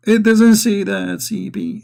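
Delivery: slow, drawn-out mock protest; mocking objection. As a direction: stretch out the words to mimic a protesting opponent. Mimicking an opponent's objection.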